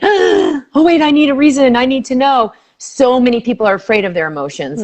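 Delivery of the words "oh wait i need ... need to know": high, startled mock fearful voice; gasping, higher mock alarm at an emotion; mock panic. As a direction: imitate someone panicking over their feelings. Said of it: "because I'm not gonna be afraid".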